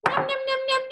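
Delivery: high playful nom-nom chant; child's high, sing-song munching sounds; eating noises. Acted. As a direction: gobble it down like a happy cartoon. Penguin drinks the water.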